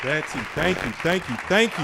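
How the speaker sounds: host thanking the storyteller warmly and loudly; enthusiastic thanks. Real, not acted. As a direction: gush your gratitude.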